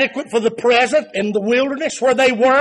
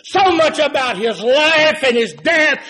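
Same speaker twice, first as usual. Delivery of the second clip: high, loud preaching cadence, hammering each "more"; full-force preaching; 6 semitones higher, 4 dB louder than usual. Acted. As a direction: preach it at full force, rising with every line. Preacher building to climax.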